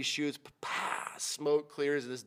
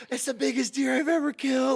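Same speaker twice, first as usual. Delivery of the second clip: high, loud gleeful phone shout; retold excited yell; 12 semitones higher, 6 dB louder than usual. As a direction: crow it down the phone to his wife. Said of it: "screaming to his wife".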